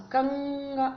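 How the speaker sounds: कंघा with the ng held through the nose; teacher isolating the nasal in कंघा; nasal ng demo. Shown as a demonstration. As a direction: hum the ng in कंघा. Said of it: "जो आवाज आ रही है ना".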